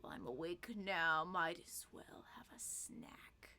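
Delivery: half-asleep yawning whisper; sleeping ancient god. Acted. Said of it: "Yawns, like".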